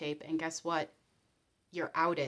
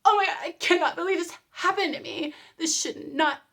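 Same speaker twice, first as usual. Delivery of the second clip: indignant whine; 8 semitones higher, 5 dB louder than usual. Retold or imitated. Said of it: "and you're like".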